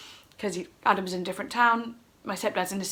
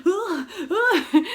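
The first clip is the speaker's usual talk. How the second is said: high, loud panicky noises; comic frantic fumbling sounds; frantic groans; 8 semitones higher, 6 dB louder than usual. Retold or imitated. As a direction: imitate flustered grunts, high and loud. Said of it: "So I'm frantically just going".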